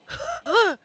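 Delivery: sharp high gasp, sucked in; choking gasp. Acted. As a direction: gasp like you breathed in poison. Acts out inhaling the poison.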